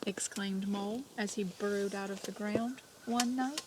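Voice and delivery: hushed storybook reading; reading to children. Acted. Teacher reads story aloud.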